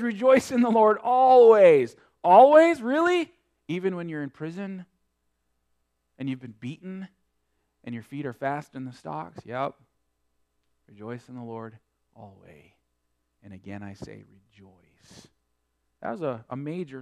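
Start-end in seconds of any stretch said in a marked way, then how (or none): 0.0-2.0 loud proclamation. Acted. Preacher proclaims Paul's command. 2.2-3.3 high, loud incredulous question; mock disbelief. Preacher voicing a doubting listener, high mock disbelief. 10.9-15.3 much softer, lower, calm and sure; quiet affirmation. Acted. Preacher answering the doubter softly and calmly.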